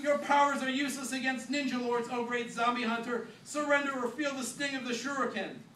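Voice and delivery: Ringo mocking Mick's pompous speech, loud parody; Mick impression. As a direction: parody the pompous zombie hunter. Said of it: "in a most excellent Mick impression".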